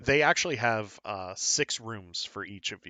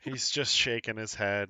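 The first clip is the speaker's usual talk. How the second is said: ordinary talk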